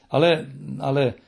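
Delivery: mildly confused, faltering protest; flustered stammer. A narrator's reading. Embarrassed engineer finds no music at the crib, flustered stammer. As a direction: stumble over it, taken aback. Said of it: "błąkał inżynier".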